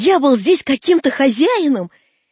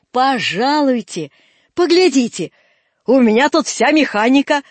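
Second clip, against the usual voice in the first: slow, raised, hearty greeting; ceremonious welcome; 3 semitones higher, 4 dB louder than usual. Acted. Old man greets the owner's son, ceremonious welcome. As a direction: welcome an honoured young master grandly.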